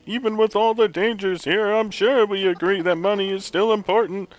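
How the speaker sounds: hammy put-on professor read; terrible Egad voice. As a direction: chatter like an excitable cartoon scientist. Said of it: "That voice is terrible".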